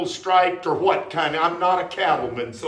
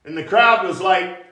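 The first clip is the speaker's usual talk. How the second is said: normal talking voice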